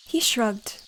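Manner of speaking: plain reading voice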